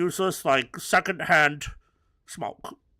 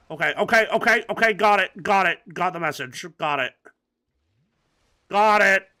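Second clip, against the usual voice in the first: rapid repeats, loud and higher; exasperated, cutting someone off; impatient acknowledgement; 5 semitones higher, 6 dB louder than usual. Real, not acted. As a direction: fire off "got it" until it becomes a shout.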